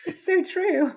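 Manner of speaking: high, loud laugh-through "so true"; bursting with delighted agreement. Real, not acted. Host laughing along with guest, high and loud.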